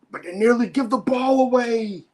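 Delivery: high, alarmed play-by-play; excited call. Real, not acted. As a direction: call the near turnover high. Live call of near turnover.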